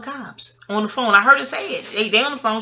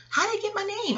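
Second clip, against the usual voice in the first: shocked; 12 semitones higher, 7 dB quieter than usual. Retold or imitated. Retold shocked reaction.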